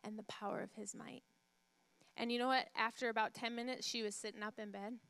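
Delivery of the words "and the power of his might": much softer, murmured together; quiet repeating. Retold or imitated. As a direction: murmur it along with her. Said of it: "he kept saying it with her".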